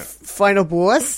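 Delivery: slow, drawn-out New Jersey accent. Shown as a demonstration. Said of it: "in your Jersey accent".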